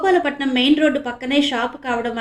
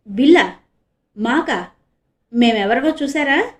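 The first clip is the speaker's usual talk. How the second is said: sharp, indignant constable, louder; sharp glare; 5 dB louder than usual. A narrator's reading. Constable sharply rebukes the shopkeeper for asking a bill. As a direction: snap back like an offended policeman. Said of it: "తీక్షణంగా ... అన్నాడు constable".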